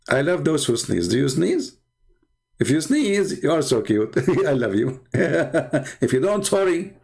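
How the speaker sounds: high, giggly mocking deity voice; mocking a divine voice that coos at sneezers; mock Allah voice. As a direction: play a cutesy god, laughing through it. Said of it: "I'm Allah".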